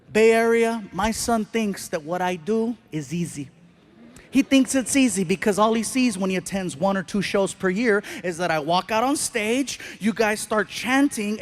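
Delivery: usual speaking voice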